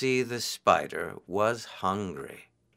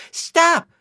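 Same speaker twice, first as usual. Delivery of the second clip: shouting; 9 semitones higher than usual. A narrator's reading. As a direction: yell at him to stop. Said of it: "Anansi shouted".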